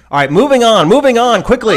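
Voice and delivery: loud, high-pitched hurrying along; urgent moving-on. Real, not acted. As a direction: call out to move things briskly on. Hurries show along after rant.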